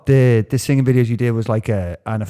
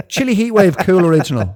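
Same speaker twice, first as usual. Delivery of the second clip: mock answer, laughing; 7 semitones higher, 4 dB louder than usual. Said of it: "he's like".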